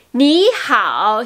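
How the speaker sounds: "Ni hao" with a clearly breathed H; sounded H. Shown as a demonstration. Teacher warning against dropping letters, audible H in "Ni hao". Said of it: "si on oublie le H".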